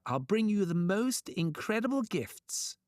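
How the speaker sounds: high, swooping salesman's promise; grand promise. A narrator's reading. Trickster tempts the thunder god, grandly promising. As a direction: dangle the prize in a high voice.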